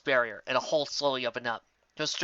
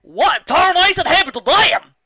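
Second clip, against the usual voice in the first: high, loud and slow country drawl; Applejack voice; 12 dB louder, slower than usual. Acted. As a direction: shout it like a startled cowgirl.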